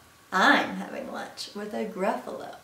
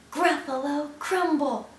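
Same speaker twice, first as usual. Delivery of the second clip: higher, relished announcement; mock threat; 5 semitones higher, 4 dB louder than usual. Mouse names favorite food to Gruffalo, mock-threatening. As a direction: deliver it as a playful punchline threat.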